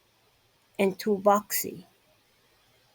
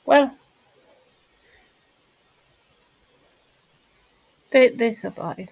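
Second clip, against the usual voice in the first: louder forceful insistence; emphatic; 3 semitones higher, 6 dB louder than usual. Real, not acted. Insisting to viewers that the men survive. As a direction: push the words out firmly.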